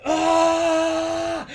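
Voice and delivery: loud, high, drawn-out strained "aah"; straining Hulk-out yell. Retold or imitated. Host mimics Banner trying to get Hulk out, straining yell. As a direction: imitate a man straining to transform. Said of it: "where he was just like".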